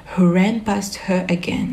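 ordinary delivery, nothing marked

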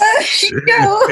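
high, laughing cry of "K O"; cracking up. Real, not acted. To her friend about the cashier story, a high laughing cry. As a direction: squeal it out through laughter.

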